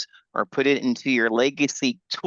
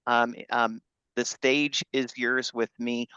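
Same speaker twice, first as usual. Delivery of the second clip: ordinary talk, nothing marked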